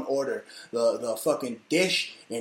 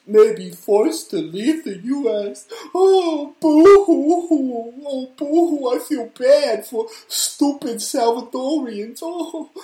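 mock whiny crier; 10 semitones higher, 8 dB louder, slower than usual. Mocking sympathizers of deported Salvadorians.

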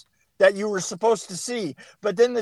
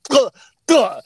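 two sharp, higher, imitated knife thuds; vocalized gutting blows; stabbing sound effect; 5 semitones higher, 4 dB louder than usual. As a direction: make the sound of a blade plunging in. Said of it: "guts the pig right here, goes".

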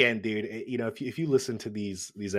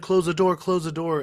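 urgent; 7 semitones higher, 4 dB louder than usual. Retold or imitated. Character urging driver to hurry.

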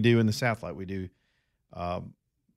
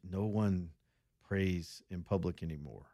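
soft, low imitation of broken English; subdued broken English with a pause midway; 4 semitones lower, 8 dB quieter than usual. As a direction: retell it in halting, quiet broken English. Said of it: "she said in very broken English".

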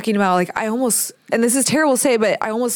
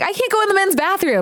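much higher and fast, a mock whine; panicked protest; 11 semitones higher, faster than usual. Mother protests to her husband, high, fast and flustered. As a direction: squeal it out, flustered. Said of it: "I'm like".

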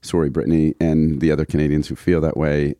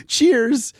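chirpy cheers. Retold or imitated. Reading listener's chirpy sign-off.

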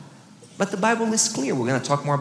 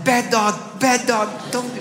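higher mock scolding of a dog; 7 semitones higher, slower than usual. Pastor carrying his runaway dog home, scolding. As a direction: scold it like a fed-up owner, half-playful. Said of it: "instead of whispering, I'm so happy".

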